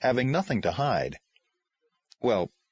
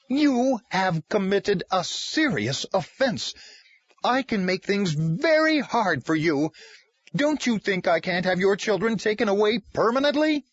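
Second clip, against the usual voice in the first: trembling with rage; 10 semitones higher, 3 dB louder than usual. Acted. Caseworker threatens Tom over his children, shaking with rage. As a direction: spit the threat through rage. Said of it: "her voice trembled with rage".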